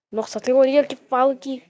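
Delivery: usual speaking voice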